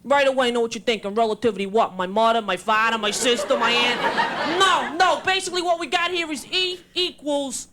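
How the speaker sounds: put-on thick Brooklyn accent, casual convention speaker; Albert Einstein, Brooklyn accent. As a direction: play a brash Brooklynite fumbling through a science speech. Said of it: "if Albert Einstein were from Brooklyn".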